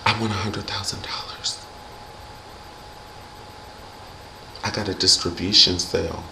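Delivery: imitating Kenya in a whisper; mock serious whisper. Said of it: "she was like".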